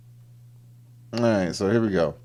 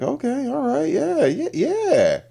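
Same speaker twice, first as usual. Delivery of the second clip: high, squeaky mock excitement; eager agreement; slower than usual. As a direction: agree giddily in a rising, high-pitched voice. Voicing man's giddy reaction.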